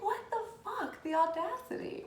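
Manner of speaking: high, laughing disbelief at the audacity; incredulous outrage. Retold or imitated. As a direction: scoff it out, amused and offended. Outraged at patronizing DM.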